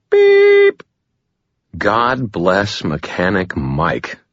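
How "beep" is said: high electronic beep. A narrator's reading. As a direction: chirp like a machine tone. Voicemail beep.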